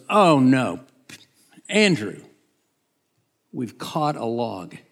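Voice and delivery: loud, acted "oh no" alarm; hammed-up dismayed outburst; sudden dismay. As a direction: call out in mock dismay. Peter's sudden acted dismay at net.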